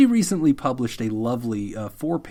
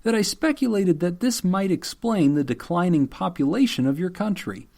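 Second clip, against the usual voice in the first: higher-pitched formal letter reading; deadpan public statement, pitched up; mock apology letter; 5 semitones higher than usual. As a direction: read it out like an official apology. Reads out second apology letter.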